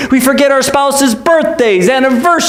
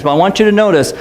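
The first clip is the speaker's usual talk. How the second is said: normal talking voice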